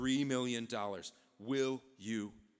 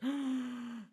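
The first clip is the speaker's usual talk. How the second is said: high, sharp intake of breath; startled gasp; 10 semitones higher than usual. Acted. President greeting the returned envoy, startled gasp. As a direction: gasp with mock astonishment.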